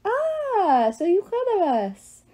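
put-on high, loud French-accented delight; fake French accent. A narrator's reading. Love god is pleased to be recognized, high French accent. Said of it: "he had a French accent so bad".

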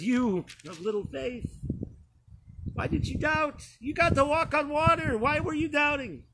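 exasperated Jesus. Acted. Jesus rebukes Peter after rescue.

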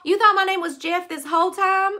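loud incredulous repeat, caller finally gets the mix-up; astonished. Real, not acted. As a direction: hit "You thought my name was Jeff" in loud disbelief. Realising the name mix-up.

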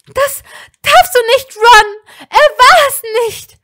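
high, loud and breaking, nearly cracking. A narrator's reading. Hermine defending him to Ron, voice cracking. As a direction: voice cracking upward in desperate protest. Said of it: "ihre Stimme überschlug sich fast dabei".

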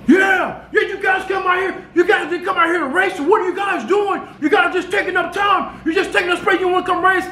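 high-pitched mock yell, pumped up; a high hammed-up taunt at the guys who won't race; imitating hyped yelling. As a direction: shout it like a hyped-up winner taunting. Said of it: "like, yelling, bro, to the top of his lungs".

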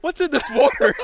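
high, loud, slowed cartoonish voice; bewildered cartoon player; cartoon Space Jam player. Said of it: "Space Jam movie".

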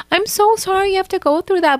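high, sweet sympathetic voice; mock sympathy. Real, not acted. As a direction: coo it as if comforting her. Reacting to the family fight.

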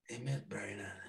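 whispering René's nudge under the breath; whispered aside. Retold or imitated. Manager nudging singer in a whisper. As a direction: hush it like a secret prompt. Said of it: "il dit".

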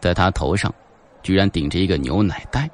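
usual speaking voice

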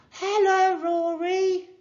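very high, loud call; high sustained shout to someone behind glass; yelled greeting. A narrator's reading. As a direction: holler hello through a window. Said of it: "she yelled".

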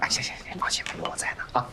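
quick whispered reassurance; hurried, hushed agreement. Acted. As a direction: whisper it fast and soothingly. Secret job talk, reassuring colleague.

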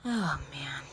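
breathy unvoiced whisper; whispered aside. Real, not acted. To herself over the cards, whispered. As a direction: whisper it to yourself.

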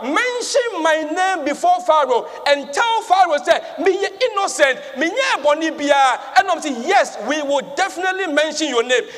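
impassioned storytelling from the pulpit; preaching. Acted. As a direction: preach it out with rising energy. Preaches at full force.

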